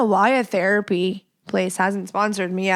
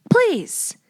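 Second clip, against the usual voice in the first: begging please; 12 semitones higher than usual. Acted. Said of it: "please share it with your wife".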